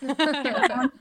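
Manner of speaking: can't stop giggling; laughing. Real, not acted. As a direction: burst of real laughter. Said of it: "I can't stop laughing".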